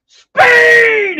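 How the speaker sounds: very high, very loud hammed-up cry; overacted anguished shout. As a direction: wail the name like a hammy villain. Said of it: "he's kind of an overactor himself".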